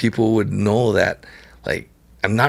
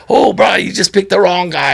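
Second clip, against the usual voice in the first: mock warning shout; 9 semitones higher, 6 dB louder than usual. Friend warning the bully.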